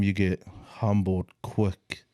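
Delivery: usual speaking voice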